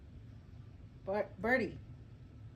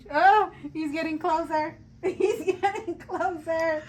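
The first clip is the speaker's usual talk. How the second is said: laughing through panic; nervous laughing; 4 semitones higher, 5 dB louder than usual. Real, not acted. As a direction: giggle it out nervously. Bird creeping toward face.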